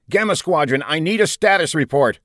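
urgent demand. A narrator's reading. Commander calling a silent squadron, insistent. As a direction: press hard for a response.

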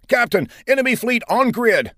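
high, urgent ensign's call. A narrator's reading. Ensign alerting the captain from the front, calling out. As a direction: call the sighting across the bridge. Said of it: "An ensign called out".

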